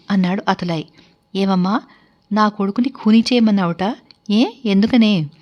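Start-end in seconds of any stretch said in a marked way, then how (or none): none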